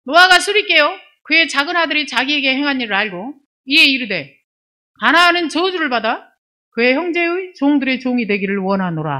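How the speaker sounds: solemn read-aloud Bible voice; scripture reading. Acted. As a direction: proclaim the verse, high and forceful.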